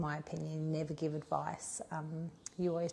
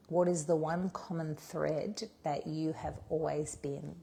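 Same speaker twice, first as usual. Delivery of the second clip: ordinary talk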